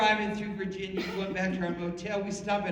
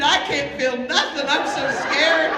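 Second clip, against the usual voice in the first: loud, raised voice of a panicking mom; scared hollering; 4 semitones higher, 7 dB louder than usual. Retold or imitated. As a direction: wail it as a frightened mother, played for laughs. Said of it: "We're screaming and hollering".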